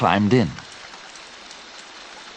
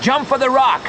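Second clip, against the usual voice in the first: high-pitched urgent command; shout; 3 dB louder than usual. A narrator's reading. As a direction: shout the cue to jump. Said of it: "shouted Joe".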